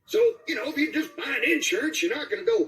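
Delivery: put-on high, rambling old-timer ending in a yelp; Dynamite voice. Shown as a demonstration. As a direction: rant it like a wild old salesman. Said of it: "Hit us with some Dynamite".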